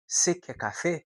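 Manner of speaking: você quer café with você clipped to se; reduced você. Shown as a demonstration. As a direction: say você as a quick se. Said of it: "ele vai falar". (você reduzido a "se")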